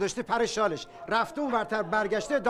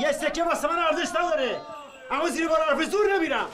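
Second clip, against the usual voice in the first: angry retort; 8 semitones higher than usual. Acted. Vendor to customer over a coin, fast angry retort. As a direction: fire it back in defiance.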